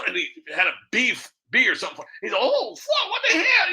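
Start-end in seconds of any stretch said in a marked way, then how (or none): 2.3-3.7 very high mock accent outburst; sudden street accent. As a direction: drop the reporter act into a high, startled accent. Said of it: "he got real quick with the accent".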